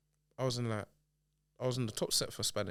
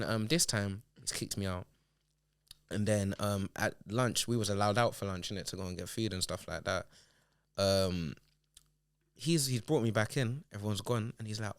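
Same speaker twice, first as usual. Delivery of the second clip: ordinary talk, nothing marked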